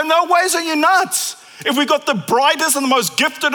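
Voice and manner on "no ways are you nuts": high, loud "no ways" in disbelief; incredulous. Retold or imitated. Delivery company refusing a wild order, incredulous. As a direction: scoff like it's crazy. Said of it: "And they're gonna go".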